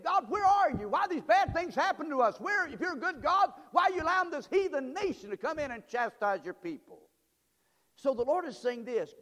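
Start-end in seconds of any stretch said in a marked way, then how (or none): 0.0-7.1 high, loud, pleading questions; impassioned prophet's complaint, raised pitch; Habakkuk. Acted. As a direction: cry out to God in protest. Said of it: "Habakkuk saying".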